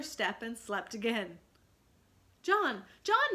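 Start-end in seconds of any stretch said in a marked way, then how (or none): 2.4-3.3 put-on high, loud call of a sleepy little boy; little brother calling urgently; crying out. A narrator's reading. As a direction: call out to wake your brother. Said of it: "Michael would cry".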